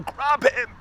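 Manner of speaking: high urgent shout; shouted order. Retold or imitated. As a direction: yell it at a stranger down the road. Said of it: "I shouted at the man".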